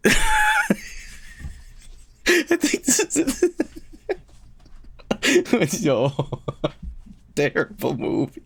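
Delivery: high laughter-filled words, slow; laughing. Real, not acted. Laughing at image.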